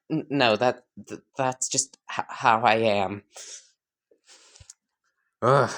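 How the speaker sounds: ordinary delivery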